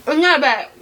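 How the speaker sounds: talking through a mouthful of food; words round a bite of food; mouth full. Real, not acted. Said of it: "I just said a whole sentence with my mouth full".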